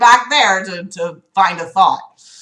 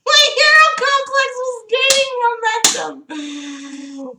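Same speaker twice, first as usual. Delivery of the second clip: silly mock-heroic squeal; 13 semitones higher than usual. Reader repeating the hero complex line, silly high mockery.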